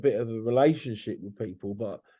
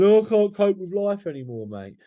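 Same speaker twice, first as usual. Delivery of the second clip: put-on drunk caller; 8 semitones higher, 4 dB louder than usual.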